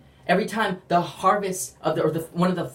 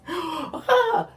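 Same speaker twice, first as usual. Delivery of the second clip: a sudden, much higher scream of awe; joyful scream; 11 semitones higher than usual. Pretend. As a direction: let out a high, amazed cry. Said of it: "we're gonna be like".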